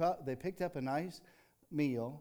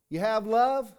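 usual speaking voice

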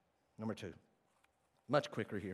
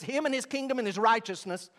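usual speaking voice

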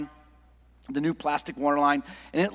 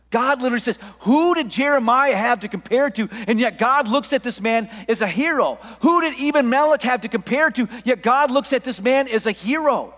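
higher, louder preacher delivery; impassioned preaching; 6 semitones higher, 5 dB louder than usual. Real, not acted. Preacher telling the congregation about God's unlikely heroes, impassioned. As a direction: hammer out each rhetorical question and answer.

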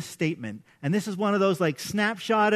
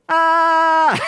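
loud, high sustained scream; demonstrated scream; 9 semitones higher, 11 dB louder than usual. Shown as a demonstration. Dad releasing scary-movie tension, loud comic scream. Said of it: "this is what I do".

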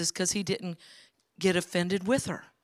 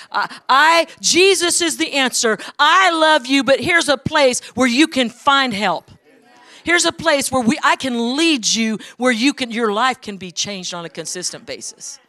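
much louder and higher, preached message; fiery sermon shout; preaching full force; 5 semitones higher, 10 dB louder than usual. Acted. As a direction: proclaim it to the whole church. Preaching the card's message loudly.

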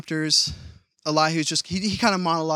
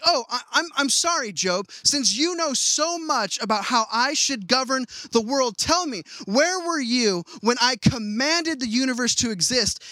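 sarcastic God; 6 semitones higher than usual. Retold or imitated. God sarcastically answering Job in modern language, higher mocking voice. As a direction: needle him with fake politeness. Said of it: "what God is saying is this".

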